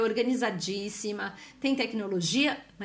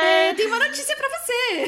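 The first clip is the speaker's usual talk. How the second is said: high, loud sing-song fake cheer; mock cheerful announcement; 13 semitones higher, 7 dB louder than usual. Parents telling the boy about full-time school, mock cheer. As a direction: sell the bad news as a treat.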